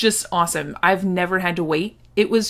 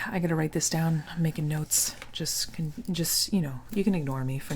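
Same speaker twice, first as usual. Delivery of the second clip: whispering; 4 semitones lower, 8 dB quieter than usual. Real, not acted. Writing notes, aside.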